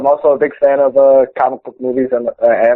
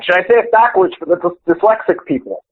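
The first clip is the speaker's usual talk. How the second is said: ordinary delivery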